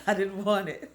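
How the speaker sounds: a wry laugh breaking through. Real, not acted. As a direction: chuckle the words out. Wry laugh about avoiding trouble.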